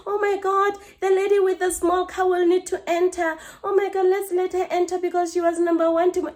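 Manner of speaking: high, sing-song pretend fretting; mock concern. Driver mocking the idea of doing favours, pretend concern. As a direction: put on a fussy, sarcastic show of caring. Mocking imagined considerate thought.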